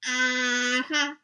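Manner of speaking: a lightly voiced guard's doubtful hum; sceptical mm-hmm. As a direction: hum it like you don't buy a word.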